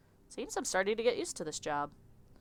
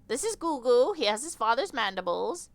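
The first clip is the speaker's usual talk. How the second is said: higher, louder doting mother voice; proud insect mother; 6 semitones higher, 5 dB louder than usual. Acted. Insect lady showing Victor her child, proud higher voice. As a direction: show off the baby photo proudly.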